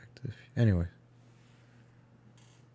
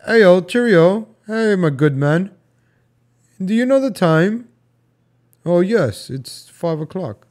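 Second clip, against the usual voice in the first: put-on higher, drawn-out greetings of an old-time gentleman; 4 semitones higher, slower than usual. Man greeting 1911 dappers and asking the time, mock-genteel. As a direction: play a cheery period gentleman. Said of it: "No, they don't say".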